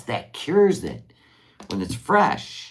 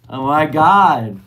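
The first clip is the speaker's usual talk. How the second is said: slow, louder, higher rapture; delighted exclamation; 6 semitones higher, 10 dB louder, slower than usual. Real, not acted. Tasting the blueberry biscuit, delighted.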